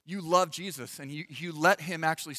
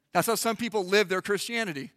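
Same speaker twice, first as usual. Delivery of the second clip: normal talking voice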